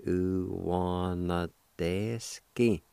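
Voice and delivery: Uwanadeski stretched out very slowly for the class; slow teaching demo of Uwanadeski; drawn out slowly. Shown as a demonstration. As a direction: lay out each sound of Uwanadeski slowly. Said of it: "It's pronounced like this".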